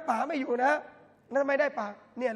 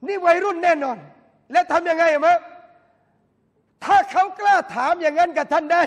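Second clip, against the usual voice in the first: loud and higher, pressing the point; emphatic preaching; 5 semitones higher, 11 dB louder than usual. Real, not acted. Preacher urging parents loudly in the sermon. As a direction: drive it home to the parents.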